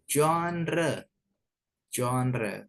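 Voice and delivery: genre said as ZHON-ruh, first syllable stressed; correct pronunciation. Shown as a demonstration. Teacher modelling the correct reading for students, genre as ZHON-ruh. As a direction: say genre as zhon-ruh, deliberately, falling at the end.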